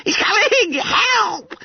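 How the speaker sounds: very high, terrified squeal; shrieking. A narrator's reading. Frightened schoolboy warning the others the man is coming, shrieking. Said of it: "shrieked Bunter".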